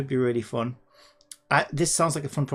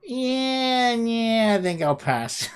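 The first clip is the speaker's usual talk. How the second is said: rough, amateur presidential impression; Trump; 9 semitones higher, 4 dB louder, much slower than usual. Streamer guessing the president turning down the idea, rough impression. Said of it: "That's my best Trump impression".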